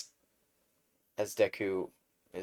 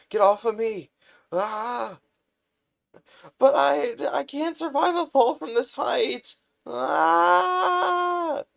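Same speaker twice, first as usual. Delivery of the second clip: much higher, louder panicked yelling; terrified of falling from the height; panicked screaming; 11 dB louder, slower than usual. Acted. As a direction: scream while falling through the sky. Dangling in midair screaming.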